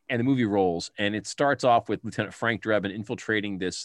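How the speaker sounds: usual speaking voice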